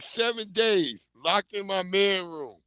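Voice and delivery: usual speaking voice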